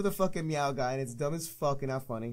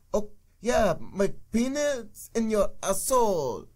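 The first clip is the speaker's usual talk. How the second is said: mocking Canadian accent, slow and higher; bad Canadian accent; 5 semitones higher, 4 dB louder, slower than usual. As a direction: ham up a fake Canadian accent. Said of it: "they do really bad Canadian accents".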